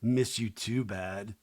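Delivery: low, quiet mock-drunk guy; tipsy boyfriend. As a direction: imitate a hammered boyfriend, low and soft. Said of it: "a little hammered".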